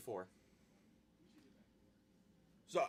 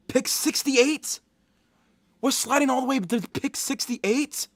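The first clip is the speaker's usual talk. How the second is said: high, loud incredulous question; outraged, rising at the end; appalled disbelief; 7 semitones higher, 7 dB louder than usual. Real, not acted. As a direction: demand it in real disbelief. Appalled at the later pick.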